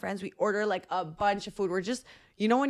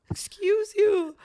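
sassy offended; 11 semitones higher, 3 dB louder, much slower than usual. Retold or imitated. Mock offended retort to friend.

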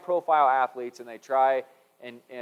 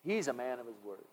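echoed compliment; 2 semitones lower, 12 dB quieter than usual. Retold or imitated. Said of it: "a compliment that we hear about people".

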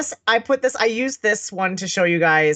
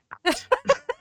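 high laugh; 3 dB quieter than usual. Real, not acted. High laugh at own joke.

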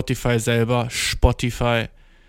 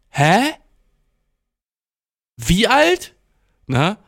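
baffled question; 6 semitones higher, much slower than usual. Retold or imitated. Storyteller asking the girl a second time, baffled. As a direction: replay total confusion, pitched up. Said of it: "Ich bin so".

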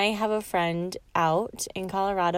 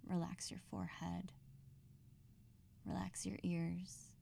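whispered cue; 26 dB quieter than usual. Acted. Breathing exercise, whispered cues.

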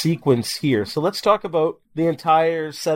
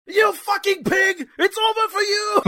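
mock angry yell. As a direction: scream it at the hero as he walks away. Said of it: "The guy goes".

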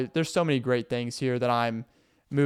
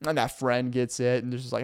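ordinary talk

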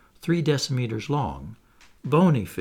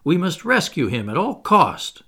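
higher, louder urgent plea; agitated; 5 semitones higher, 5 dB louder than usual. A narrator's reading. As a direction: urge a rescue with agitated force. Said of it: "and in an agitated voice".

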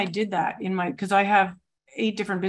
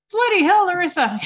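much higher and louder, a badly faked Irish exclamation; mock Irish woman blurting it out, much higher; attempted Irish accent; 10 semitones higher, 7 dB louder than usual. Chat-line friend scolding Larissa, in a much higher, louder, badly faked Irish accent. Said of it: "I can't do an Irish accent".

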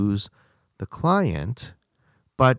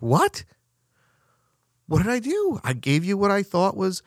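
baffled disbelief; 8 semitones higher than usual. Retold or imitated. Surgeon baffled, acted quote.

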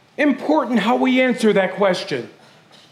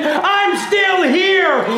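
shouted declaration; 7 semitones higher, much slower than usual. Shown as a demonstration. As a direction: cry it out slowly to the whole church. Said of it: "like I'm shouting".

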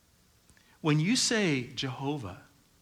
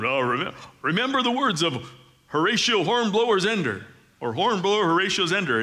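tongue-in-cheek pronouncement, pitched up and louder; joking little voice; 5 semitones higher, 5 dB louder than usual. Retold or imitated. Dad joking around with his daughter, little put-on voice. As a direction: put on a mock-grand family-motto voice. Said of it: "I would do a little voice".

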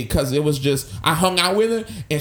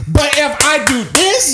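"but if I do this" punched loud and high; added emphasis; 10 semitones higher, 6 dB louder than usual. Shown as a demonstration. Showing his friend how claps add punch, emphatic. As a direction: hit each word of "but if I do this" hard. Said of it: "emphasis. Always emphasis".

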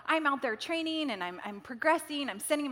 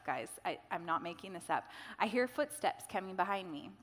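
usual speaking voice

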